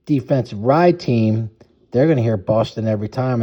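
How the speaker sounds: usual speaking voice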